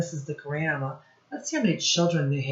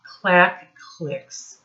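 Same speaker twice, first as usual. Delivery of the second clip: lively read-aloud, sound words popped out; reading to children; 4 semitones higher, 3 dB louder, much slower than usual. Acted. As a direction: read it to a class of little kids, playing up the noises. Teacher reads rhyming picture book.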